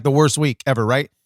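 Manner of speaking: usual speaking voice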